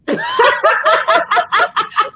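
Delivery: high giggling laugh; high burst of laughter. Real, not acted. Admits being untechnical.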